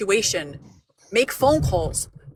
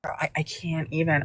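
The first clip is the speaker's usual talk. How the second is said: whispered, slow and soft, at a loss for words; breathless whisper; 4 semitones lower, 5 dB quieter than usual. Real, not acted. To her stream, stunned into a soft whisper.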